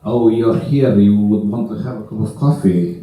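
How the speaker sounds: low-pitched mock Russian accent. Russians receive a guest asking for coffee, Russian accent. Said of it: "In fact, they say".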